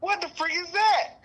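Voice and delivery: high, loud indignant squawk; outraged, is she serious; high-pitched disbelief; shocked outrage. Retold or imitated. Mock outrage at being called boo.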